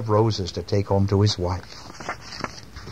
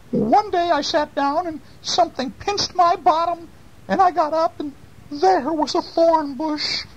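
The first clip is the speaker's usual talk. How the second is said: put-on very high-pitched old-lady voice; high storybook old woman; old lady recounting her trouble. A narrator's reading.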